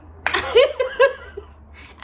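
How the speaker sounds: high, loud laugh. Real, not acted. Recalling the silent nurse walking out, laughing loudly.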